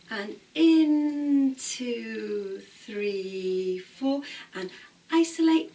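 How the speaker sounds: loud rhythmic counting of the beats; dance class count. Shown as a demonstration. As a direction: call the counts out to the class in time. Dance teacher counting out moves.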